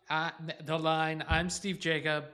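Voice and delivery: ordinary delivery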